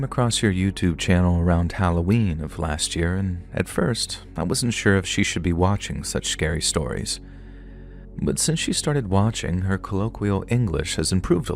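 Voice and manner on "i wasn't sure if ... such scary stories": ordinary delivery, nothing marked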